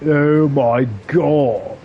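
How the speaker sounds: put-on slow, drawn-out south Dublin accent. Showing how south side Dubliners talk, south Dublin accent. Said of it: "south side of Dublin, where they talk a bit more like".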